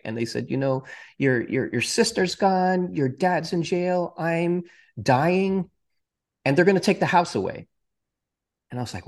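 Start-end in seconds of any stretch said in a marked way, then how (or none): 0.5-7.7 upset mom. Retold or imitated. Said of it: "She started yelling at me".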